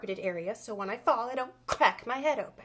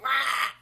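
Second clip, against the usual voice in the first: screeching mock sob; baby pterodactyl cry; 3 semitones higher, 4 dB louder than usual. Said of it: "like a baby pterodactyl calling for a mom".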